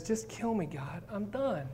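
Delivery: a weary man asking to die; despairing plea. Acted. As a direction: sound like you've given up. Elijah begging to die.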